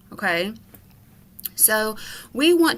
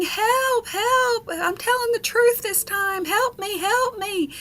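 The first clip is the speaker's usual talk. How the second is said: put-on high, desperate child's cries for help; a much higher, acted scream for help; boy screaming for real; 12 semitones higher, slower than usual. A narrator's reading. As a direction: plead to be believed, frightened. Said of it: "the little boy was screaming".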